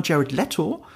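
"Leto" said as LEH-toh; preferred pronunciation. Shown as a demonstration. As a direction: say "Jared Leto" with an eh, not ee. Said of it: "ich sag aber in meinen Videos schon immer". ("Leto" mit e, wie "Letto")

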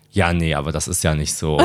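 low, quiet mocking imitation of her; mocking killjoy. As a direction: imitate her dull dismissive tone. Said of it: "da warst du auch so".

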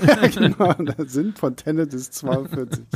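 laughing along at the joke. Real, not acted.

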